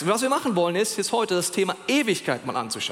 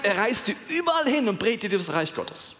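normal talking voice

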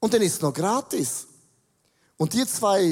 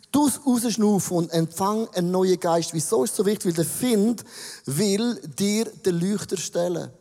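usual speaking voice

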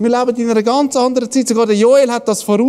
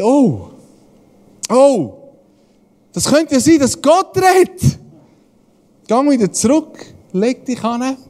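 rising higher and higher in dawning surprise; slow, wondering realization climbing up; Eli's realization; 4 semitones higher, slower than usual. Retold or imitated. Eli realizes God may be speaking, amazed wonder. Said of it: "Und dann merkt Eli".

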